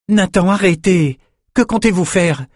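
put-on high, startled young man's outcry; alarmed protest. A narrator's reading. Startled youth protests at sword attack.

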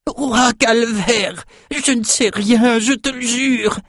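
very high, slow desperate plea; pleading in pain. A narrator's reading. Tortured cook swearing to the king, terrified. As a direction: beg for mercy through pain. Slave pinned with knife, pleading.

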